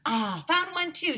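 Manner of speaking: high excited call; shouted. A narrator's reading. Teacher shouting she found gold too. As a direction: call out the find. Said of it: "shouted Miss Small".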